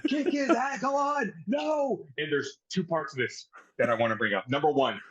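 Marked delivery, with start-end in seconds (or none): 0.1-2.0 high, hammed-up cheering shouts; mock ringside mama. Mama cheering her son Shelton from ringside, high mock shouts. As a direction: yell for your boy like a wild fan.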